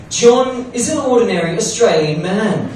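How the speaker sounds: mock news report, loud and earnest; news anchor voice. Comic telling the crowd a fake news report, mock anchor delivery. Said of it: "thing you see on Australian news".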